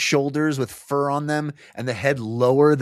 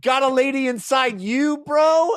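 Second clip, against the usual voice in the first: mocking taunt; 11 semitones higher, 4 dB louder than usual. Agia taunting the soldier.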